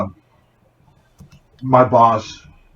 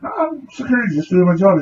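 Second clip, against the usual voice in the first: mock innocent; 9 semitones higher than usual. Mock-polite reply to shoplifter.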